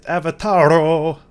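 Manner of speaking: playful put-on Latin accent, rolled R; different accent. Shown as a demonstration. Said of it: "in a different accent".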